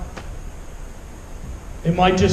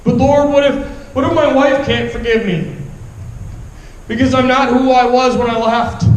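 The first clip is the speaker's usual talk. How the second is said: anguished plea; 3 semitones higher than usual. Retold or imitated. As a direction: cry out desperately to God. Pleading with God.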